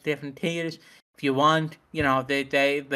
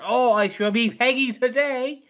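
mock resurrected character; 8 semitones higher, 3 dB louder than usual. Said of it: "not rising out of her fucking grave going".

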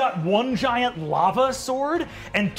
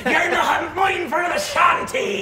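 high, shouted pirate roar; roaring pirate captain; 8 semitones higher, 3 dB louder, slower than usual. Acted. Pirate captain attacking a woman through the scroll, roaring. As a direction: bellow it like a crazed pirate.

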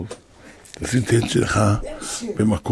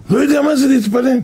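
incredulous repeat; 9 semitones higher than usual. Real, not acted. Preacher echoing the boy's words to the congregation, incredulous. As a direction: repeat it in disbelief.

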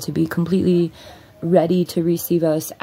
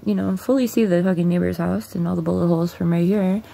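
normal talking voice

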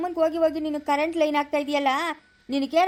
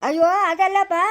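loud, high protest; raised-voice dismay; exasperated outcry; 4 semitones higher, 5 dB louder than usual. Acted. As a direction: cry out in exasperation. Exasperated cry at listener's excuse.

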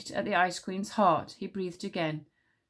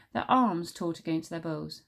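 usual speaking voice

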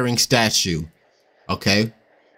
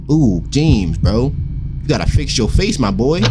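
ordinary talk

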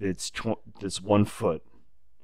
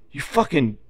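a touch of the boss's anger, slightly louder; pissed-off boss; 6 semitones higher, 6 dB louder than usual. Retold or imitated. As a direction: spit it out like an annoyed boss. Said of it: "He's actually pissed".

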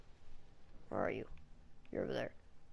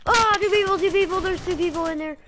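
high, loud excited callout; alarmed shout; 9 semitones higher, 9 dB louder than usual. Real, not acted. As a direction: shout the warning, startled. Spots enemies, alarmed.

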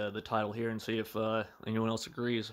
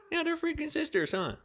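squealed way up high and louder; delighted squeal; 7 dB louder than usual. Real, not acted. Delighted at the cats.

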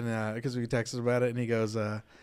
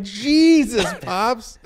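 loud, high burst of dismay; retold exasperation, big and high; exasperated disbelief; 9 semitones higher, 8 dB louder, much slower than usual. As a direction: exclaim it like a scandalized son. Retells exasperated reply to dad.

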